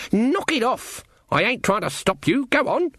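put-on high, impatient old-witch voice; goading Granny witch. Granny daring the girl to knock her hat off, goading. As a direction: egg her on, pitched well up.

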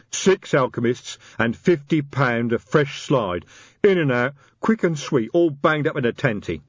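slow, proud troll voice; a hint of a pleased troll; lightly voiced troll. Detritus troll voice.

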